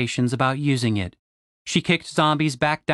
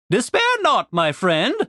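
put-on kindly old professor; 7 semitones higher than usual. A narrator's reading. Professor, high grand voice.

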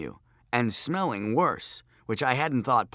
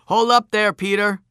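calling out; 9 semitones higher, 9 dB louder than usual. A narrator's reading. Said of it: "I said".

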